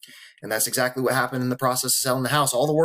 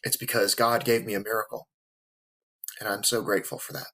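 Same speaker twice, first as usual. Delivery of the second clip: lower, softer, fighting back tears; quietly moved, grateful through the lump in the throat; emotional; 3 semitones lower, 4 dB quieter than usual. Real, not acted. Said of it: "there's a reason I'm emotional".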